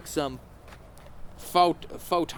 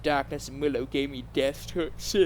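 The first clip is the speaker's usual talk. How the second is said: plain reading voice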